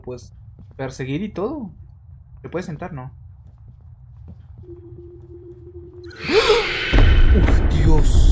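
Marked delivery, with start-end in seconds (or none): 4.2-7.3 sharp frightened gasp at a jump scare; loud gasp. Real, not acted. Gamer startled by a screamer, loud gasp. 7.4-8.3 loud startled cry; startled shout. Real, not acted. Gamer shaken by a jump scare, loud startled cry.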